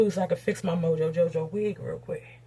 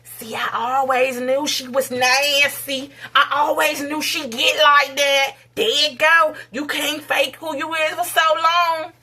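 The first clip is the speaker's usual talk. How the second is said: loud, drawn-out gloating accusation, pitched higher; mock critics; 4 semitones higher, 5 dB louder than usual. People reacting to her speaking out, mock accusing. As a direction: imitate a smug I-told-you-so, slow and loud. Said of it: "People about to be like".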